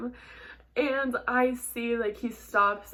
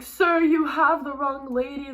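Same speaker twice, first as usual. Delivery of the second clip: higher, louder retold panicked plea; freaking out; 4 semitones higher, 6 dB louder than usual. Rider to her driver on the phone, freaking out. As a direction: act out an urgent protest to a driver. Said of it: "freaking out".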